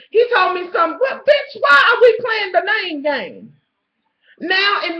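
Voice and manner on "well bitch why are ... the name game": high, loud hammed-up man's voice; mock sleazy man. Stranger in the store calling her out, hammed-up loud voice. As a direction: play the creep, loud and cocky.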